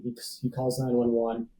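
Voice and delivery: normal talking voice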